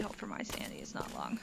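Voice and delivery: hushed, quick whisper; soft, hurried, voiceless plea; whispered hope. Real, not acted. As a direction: whisper it under your breath. Repeats the hope under breath.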